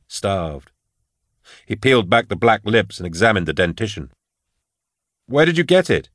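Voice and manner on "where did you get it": much higher, calling across the room. A narrator's reading. Drusha to Falcon across the morgue, calling out. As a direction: pitch the question up to carry. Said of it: "he called out to Falcon".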